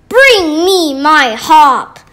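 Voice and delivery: very loud roar; giant roaring. Acted. Greedy giant roaring for his golden harp. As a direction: bellow the order. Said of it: "roared the giant".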